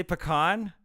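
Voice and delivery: "pecan" as puh-KAHN, in a surprised question; Northern pecan. Shown as a demonstration. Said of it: "You say".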